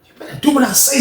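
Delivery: high, strained mock threat; heated high imitation; violent threat, retold. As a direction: order it as if fighting. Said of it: "The jump sounded so violent".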